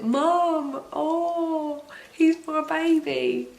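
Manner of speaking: put-on "Mum" called out high and teary, then "he's my baby" choked; tearful high cry. New mum calling to her mum, tearful and high. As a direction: cry out to your mum, overwhelmed. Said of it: "I just cried my eyes out".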